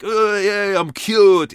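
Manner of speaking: high, loud mock-smug voice; mocking smug person. Mocking vaccinated people, high loud mock-smug voice. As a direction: sneer it like a know-it-all. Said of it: "they're all like".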